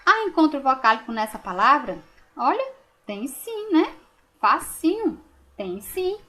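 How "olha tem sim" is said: usual speaking voice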